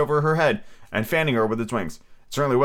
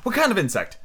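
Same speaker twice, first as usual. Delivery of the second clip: anxious; 8 semitones higher than usual. A narrator's reading. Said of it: "a little anxiously".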